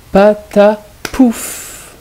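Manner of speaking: "Patapouf" very slow, three beats with a long "ouf"; syllable by syllable. Shown as a demonstration. Teacher ending the word list, "Patapouf" split into syllables. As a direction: punch out "Patapouf" one syllable at a time. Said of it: "il faut bien écouter la deuxième syllabe".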